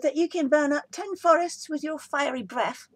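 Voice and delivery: butter him up with wide-eyed wonder; flattering princess. A narrator's reading. Princess flattering the dragon, high and sweet.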